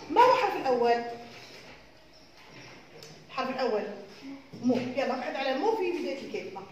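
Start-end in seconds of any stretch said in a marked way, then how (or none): none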